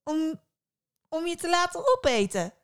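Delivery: put-on very high, lilting girl's voice; high girl, sing-song menace; teasing girl. A narrator's reading. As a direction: tease with a high-pitched question. Girl teases with a sinister question.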